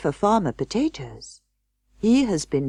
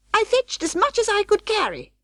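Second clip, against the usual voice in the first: much higher, thin rat-wife voice, defensive; Anna Maria voice. Acted. Rat wife defending herself to her husband, high and squeaky.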